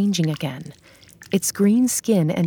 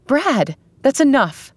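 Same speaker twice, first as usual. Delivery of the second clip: yell; 7 semitones higher than usual. Acted. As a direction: yell at him to stop. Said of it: "Carrie yelled".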